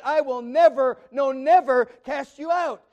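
"never" hit loud, both times, slowing down; emphatic proclamation. Real, not acted. Preacher assuring believers they will never be cast out, loudly. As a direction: proclaim it, no doubt allowed.

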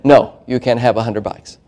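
low, soft mock-parental refusal; refusing parent. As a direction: quietly shut the request down. Parent refusing money request.

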